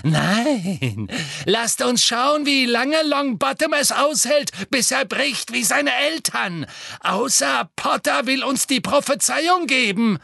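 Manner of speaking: high, loud, gleeful cruelty; shrieky high villainess relish; thrilled villainess. A narrator's reading. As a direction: rise into wicked excitement, threatening torture. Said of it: "vibrierte vor Erregung".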